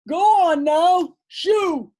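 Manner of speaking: loud high shoo. Acted. Men shoo the dog from their song, shouting. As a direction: drive the dog away, shouting.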